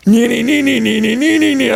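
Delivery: rising nee nee nee sound effect; high mock spinning noise. Acting out the corkscrewing, high playful sound effect. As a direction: chant it like a whirring spin.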